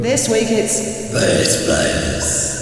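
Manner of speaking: slow, echoing announcer read with reverb; spooky reverb announcement. Shown as a demonstration. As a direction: draw it out as if through a big echo. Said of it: "bit of reverb?".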